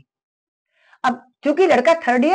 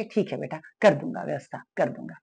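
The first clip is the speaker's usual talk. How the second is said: father's weary reply; 5 semitones lower, 7 dB quieter than usual. Retold or imitated. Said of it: "पापा बोले".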